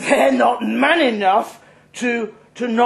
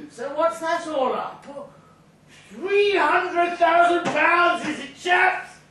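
put-on boastful drunk; 10 semitones higher than usual. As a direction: savour every pound like a show-off. Drunk character boasting total.

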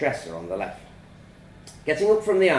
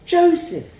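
startled mum's high, loud cry; 8 dB louder than usual. A narrator's reading. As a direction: call out sharply in high-pitched alarm. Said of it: "She looked startled at my entrance".

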